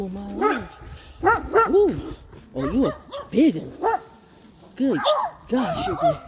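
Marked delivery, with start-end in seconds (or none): 1.7-6.2 high, excited voice for the dogs; sudden high pet-talk. Real, not acted. Talking to dogs at fence.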